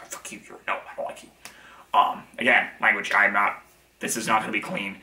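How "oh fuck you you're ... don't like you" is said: whispered goblin jeer under the breath; mock goblin taunt. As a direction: sneer it quietly like a spiteful goblin. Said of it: "'cause they're like".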